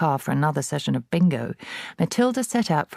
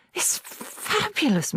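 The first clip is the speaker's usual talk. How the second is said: delighted Matilda; slower than usual. A narrator's reading. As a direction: marvel at the bird. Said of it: "Matilda said".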